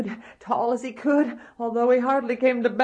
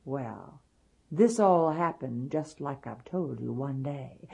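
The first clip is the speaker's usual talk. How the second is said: ordinary delivery, nothing marked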